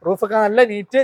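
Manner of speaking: usual speaking voice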